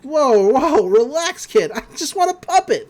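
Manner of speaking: high, loud, slick villain; a hammed-up Bill calming the kid; sly demon voice. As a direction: wheedle like a grinning demon. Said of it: "He's like".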